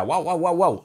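playful woof woof; mock barking. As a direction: bark on command, hammed-up. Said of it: "Ahora ladra".

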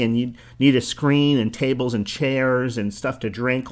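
ordinary delivery

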